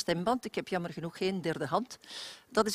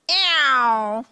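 loud, high twangy "eeuw"; twang; 4 semitones higher, 10 dB louder than usual. Shown as a demonstration. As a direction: squeeze a ringing nasal whine. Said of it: "Dat is ook twang".

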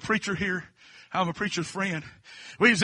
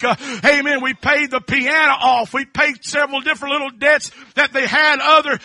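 loud, high, driving preacher voice; preaching at full force; 7 semitones higher, 10 dB louder than usual. Real, not acted. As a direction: hammer each phrase to the congregation.

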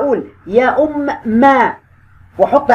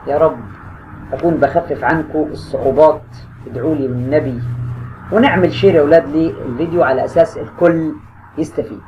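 low, soft, sincere signoff; soft closing; 6 semitones lower, 3 dB quieter than usual. Real, not acted. Teacher asking students for prayers at the end. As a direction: end gently with a heartfelt request. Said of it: "closing prayer and signoff".

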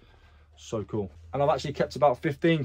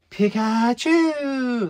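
high, drawn-out Pikachu cry; stretched, high-pitched attempt at Pikachu; bad Pikachu accent; 10 semitones higher, 4 dB louder, much slower than usual. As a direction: squeal it out like a poor Pikachu, very slowly. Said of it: "That's not the best accent".

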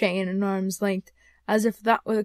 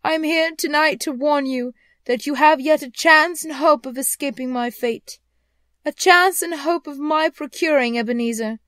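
high, loud, earnest phantom; warning ghost; 6 semitones higher, 8 dB louder than usual. Acted. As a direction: warn him solemnly from beyond the grave. Ghost warns Scrooge.

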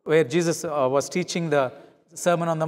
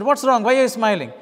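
higher, louder irritated demand; angry snap; 5 semitones higher, 5 dB louder than usual. Retold or imitated. Preacher retelling how he snapped at his wife Rosie over his glasses, angry. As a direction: snap at your smiling wife. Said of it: "I was getting angry and mad".

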